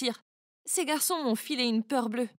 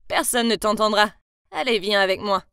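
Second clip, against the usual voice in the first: low, menacing and smug; 4 semitones lower, 5 dB louder than usual. Acted. The boy tells Hailey no one will hear, low and menacing. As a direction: lean in and threaten her.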